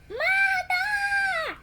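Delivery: high, loud anguished cry; crying out for mother. Acted. Girl crying out as her mother dies, loud wail. Child voicing girl in story.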